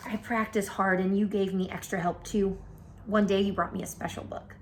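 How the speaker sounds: usual speaking voice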